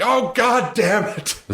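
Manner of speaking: high, slow hammed-up groan of a line; drawn-out, put-upon curse; exasperated mock voiceover. Host imagines the actor fed up on hearing Clem again. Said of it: "be like".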